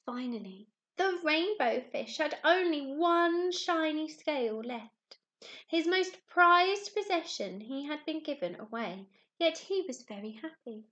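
lively storybook narration for little ones; animated picture book reading; reading a story to children. A narrator's reading. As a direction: tell it like a bedtime story to a class. Teacher reads picture book aloud.

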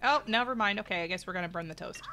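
normal talking voice

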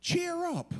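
high, put-on chirpy encouragement; mock cheerful. Crowd suddenly nice to the man they silenced, mock cheerful. Mocking the fickle crowd again.

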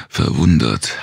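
usual speaking voice

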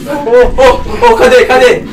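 normal talking voice

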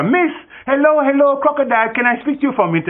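high, loud calling out across the river; calling scorpion. Acted. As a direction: shout up to the crocodile, high and eager. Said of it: "the scorpion yells to her".

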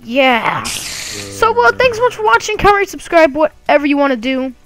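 high, fast youtuber sign-off; upbeat outro. Acted. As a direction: rattle off the goodbye cheerily.

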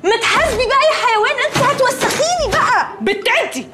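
high, shrieking yell laced with laughter; furious high-pitched yell at a sister; angry shout. Acted. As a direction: scream at her sister half-laughing. Sisters bickering over wet laundry.

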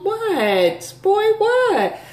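put-on high, incredulous "what, boy, what"; acting scandalised at a stranger's stare; mock shocked. Imitating her own shocked reaction to a man's look.

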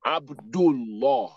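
Abdullah said slowly as "ab-dul-LAW"; heavy Lam. Shown as a demonstration. Teacher showing Lam after Dhamma, heavy Lam. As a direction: draw out Abdullah with a rounded, heavy "law" ending. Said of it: "Because Dhamma is coming before it".

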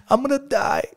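put-on a little boy giving the answer; small child's voice. Son's voice in dialogue.

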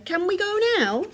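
put-on high, eager child's question; Baby Bear. A narrator's reading. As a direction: ask excitedly as a little bear. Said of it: "cheered Baby Bear".

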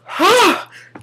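big, high, loud huff of frustration; a hammed-up sigh of despair; exasperated sigh. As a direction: let out a fed-up, high sigh. Ranting about ants on picnic.

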